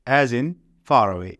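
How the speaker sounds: "far away" with the R sounded and run into "away"; linked R in far away. Shown as a demonstration. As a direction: sound the R in "far away" because a vowel follows. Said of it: "far اور پھر away". ("far away" میں آر پورا ادا کرنا)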